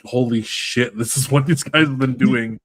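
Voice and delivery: mock astonishment. Retelling Superman holding up the world for Atlas, mock astonished. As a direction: exclaim it as someone suddenly realizing.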